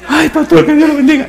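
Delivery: very high, loud squeal; hammed-up, squealing thanks to the pastor; overjoyed imitation. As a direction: imitate a man bursting with gratitude. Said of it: "me dice él emocionado".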